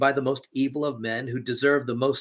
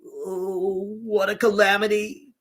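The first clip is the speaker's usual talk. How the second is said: high, loud anguished wail; wailing exclamation; 8 semitones higher, 3 dB louder, slower than usual. A narrator's reading. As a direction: wring the words out in dismay. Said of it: "the innkeeper exclaimed".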